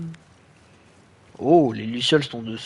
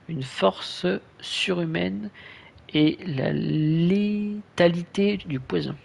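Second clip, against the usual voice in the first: slow, drawn-out dramatic reading; 4 semitones higher, much slower than usual. Real, not acted. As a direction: read it like a trailer. Reading skill description.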